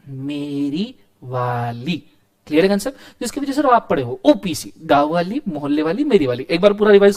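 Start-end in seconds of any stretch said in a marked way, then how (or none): 0.1-2.0 slow soft punchline. Shown as a demonstration.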